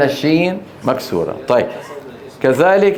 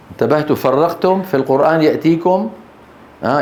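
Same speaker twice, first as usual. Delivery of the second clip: usual speaking voice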